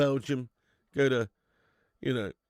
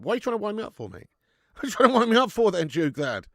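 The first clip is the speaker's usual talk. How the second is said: mock outrage; 10 semitones higher, 10 dB louder, faster than usual. Real, not acted. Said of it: "I said that in jest to wind you up".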